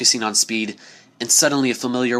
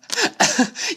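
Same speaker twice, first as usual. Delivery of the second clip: laugh. Acted. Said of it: "Rainbow Dash laughed".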